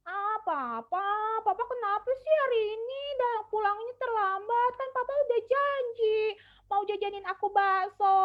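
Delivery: put-on child voice. Shown as a demonstration. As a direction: complain like a small child let down by dad. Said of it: "misalkan untuk anaknya". (suara anak kecil yang merengek, jauh lebih tinggi)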